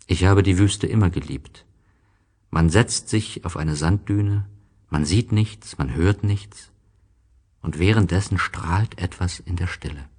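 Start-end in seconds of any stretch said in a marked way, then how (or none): none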